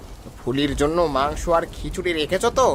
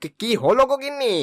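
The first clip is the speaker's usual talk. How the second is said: high, startled husband's question; raised-pitch bewildered alarm; 7 semitones higher than usual. Acted. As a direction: ask what all the fuss is about. Husband startled by wife's outcry.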